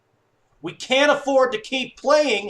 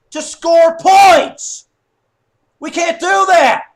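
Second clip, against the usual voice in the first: high, loud scolding; exasperated shouting; 7 semitones higher, 10 dB louder, slower than usual. Real, not acted. Fan scolding his team's slow start, shouting. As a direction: hammer it out at full voice.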